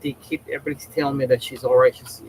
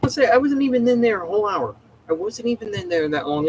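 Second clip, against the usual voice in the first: indignant; 7 semitones higher than usual. Real, not acted. Complaining about the wait.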